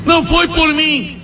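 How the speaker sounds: pleading mother. Acted. Mother answering her conscience.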